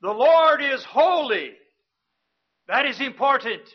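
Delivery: ordinary talk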